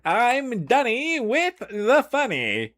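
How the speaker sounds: very high, slow yell; bellowing. Acted. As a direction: scream it out, stretching every word.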